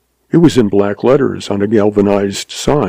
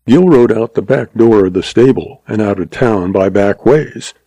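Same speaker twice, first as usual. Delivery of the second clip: ordinary delivery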